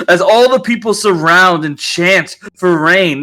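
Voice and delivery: ordinary talk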